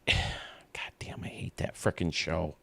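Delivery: unvoiced whispered grumble; hushed aside about a hated show. Real, not acted. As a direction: whisper it like a secret grudge. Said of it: "confiding hate of the show".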